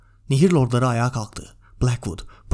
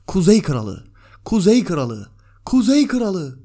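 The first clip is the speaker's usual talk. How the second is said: loud chant climbing higher with each repeat; rousing acclamation, louder and rising in pitch; crowd's cry; 5 semitones higher, 5 dB louder, slower than usual. A narrator's reading. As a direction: shout it like a hall of lords, building each time. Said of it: "haykırıyorlardı".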